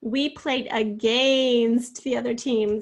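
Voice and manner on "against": louder, long-stretched AGAINST; drawn-out word. Shown as a demonstration. Teacher making pupils really hear against, stretched out. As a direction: hold the gain in against. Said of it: "kind of draw that out".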